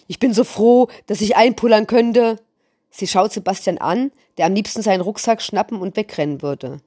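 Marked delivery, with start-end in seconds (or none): 0.1-2.4 higher and louder, wild eerie joy; loud eerie cheer. A narrator's reading. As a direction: throw your fists up and yell it, a bit unhinged. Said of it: "ruft ganz laut mit einer unheimlichen Stimme".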